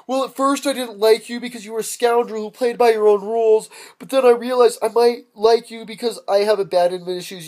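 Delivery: badly faked girl's voice; earnest confession from a pretend heroine; bad chick voice. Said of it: "can't really do a chick voice very well".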